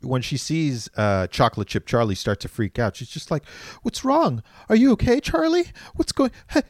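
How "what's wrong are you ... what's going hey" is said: high-pitched, anxious questions, hurried at the end; mock concerned woman. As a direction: imitate a clueless, fretting film heroine. Voicing oblivious woman's worried questions.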